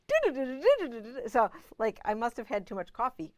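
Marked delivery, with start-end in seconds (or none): 0.1-1.3 imitating Frank humming. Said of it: "Frank is like".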